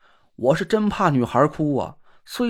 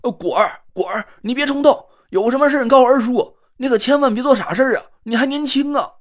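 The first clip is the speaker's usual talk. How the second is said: raised, fast pleading call; anxious calling out; 6 semitones higher, 3 dB louder than usual. Acted. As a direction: shout to stop her doing something rash. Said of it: "大喊起来".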